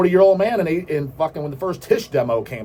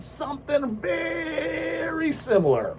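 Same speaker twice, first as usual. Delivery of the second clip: mock wail; 12 semitones higher, 5 dB quieter, much slower than usual. Mock wailing over betrayal.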